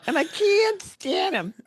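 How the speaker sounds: very high, slow, screechy-diva caricature; hammed-up movie diva impression; Lena Lamont. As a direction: draw out "can't" in a squeaky put-on voice. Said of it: "It's like Lena Lamont".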